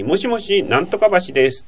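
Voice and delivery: imitated caller's high hello; telephone greeting. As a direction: act out answering with the place name on a call. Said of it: "電話かけた時に".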